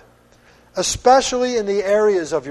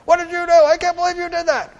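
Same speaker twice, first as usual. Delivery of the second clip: high-pitched yelling, fast and frantic; mimicked parent yelling; 9 semitones higher, much faster than usual. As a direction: act out a parent screaming at the kids. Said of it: "and yelling".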